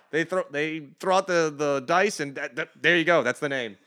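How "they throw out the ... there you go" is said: normal talking voice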